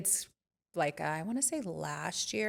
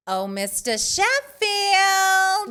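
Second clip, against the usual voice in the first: much higher, very slow, louder Nanny call; Fran Drescher impression; 14 semitones higher, 10 dB louder, much slower than usual. Copying her friend's Nanny line, high and very slow. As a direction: draw out Sheffield, high and loud. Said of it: "There you go".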